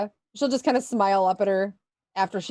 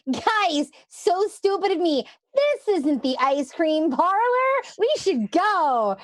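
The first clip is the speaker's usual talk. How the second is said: higher, sing-out put-on innocence, slowing at the end; fake sheepish realization; 6 semitones higher, slower than usual. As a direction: play dumb in a high voice, stretching the last words. Charity fakes realizing their mistake.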